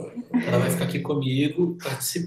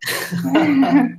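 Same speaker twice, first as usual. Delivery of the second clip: burst of laughter; 10 semitones higher than usual. Real, not acted. Guest says she's from malaria.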